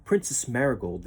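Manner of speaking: plain reading voice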